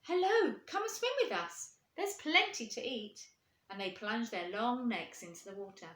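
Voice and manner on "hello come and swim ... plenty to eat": high, bright invitation; friendly swans. Acted. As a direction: welcome the newcomer kindly.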